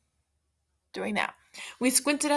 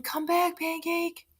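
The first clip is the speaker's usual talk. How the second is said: mock yell; 8 semitones higher, slower than usual. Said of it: "the mom were yelling".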